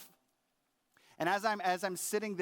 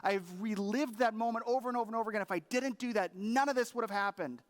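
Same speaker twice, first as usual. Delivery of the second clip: higher, heated protest to his wife; angry protest; 5 semitones higher than usual. Retold or imitated. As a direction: argue back hard, voice raised high. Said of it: "I'm like".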